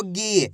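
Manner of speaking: गी with a long, falling ee; drawn-out vowel. Shown as a demonstration. Teacher drilling the long matra, गी drawn out. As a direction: stretch the ee in गी. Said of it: "खींच खींच के उच्चारण".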